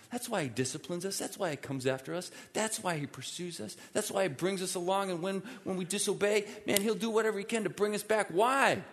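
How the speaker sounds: rising, urgent pulpit delivery; building sermon crescendo. Acted. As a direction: build insistently to a loud, high final question. Preaching builds to climax.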